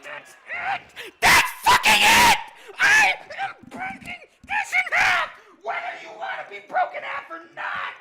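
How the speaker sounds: high, very loud threat; furious screaming. Real, not acted. Gamer threatening to break the controller, furious screaming. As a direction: scream it, completely fed up.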